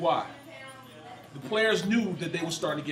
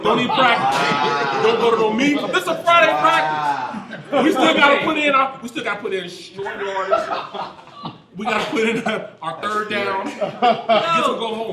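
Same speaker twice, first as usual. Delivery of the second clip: loud, high, drawn-out disbelief; incredulous outburst; 5 semitones higher, 12 dB louder, slower than usual. Retold or imitated. Ex-player protesting that his teammate skipped Friday practice, incredulous shouting. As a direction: holler the punchline over the room's laughter. Punchline of the story, mock protest.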